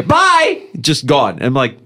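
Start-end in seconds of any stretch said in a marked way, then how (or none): 0.0-0.6 a sudden high, clipped bye; curt dismissive bye. Retold or imitated. Imitating his daughters leaving, a high dismissive bye. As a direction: toss off bye in a high squeak. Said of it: "It's just a".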